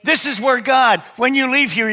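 high, loud, full-force preaching; preaching shout. Real, not acted. Pastor shouting the Word to his church, fervent and loud. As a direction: belt it out to the congregation. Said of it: "It's not about my shouting".

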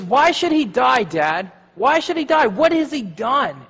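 high, loud protest; Jonathan angry. Retold or imitated. Jonathan defends David to his father, high angry protest. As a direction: cry out against a father's injustice. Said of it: "gets angry and says".

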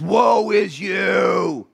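high, very slow drawn-out shout; fired-up prophet. Acted. Fired-up prophet denouncing the people, long high shout. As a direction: thunder it out, stretching every word. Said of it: "He's pretty fired up".

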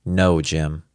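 ordinary delivery, nothing marked

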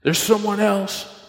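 high, drawn-out mock dread; freaking out. Husband fearing his wife has someone else, acted freak-out. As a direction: stretch it out in retold panic. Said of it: "just freaking out".

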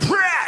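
sudden high, loud "Prah!" as the arm is pulled; mock yank noise.

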